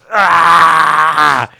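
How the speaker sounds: loud, high drawn-out "ah!"; hammed-up furious scream; mock rage scream. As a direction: yell out a sustained battle cry. Acting out snapping in rage.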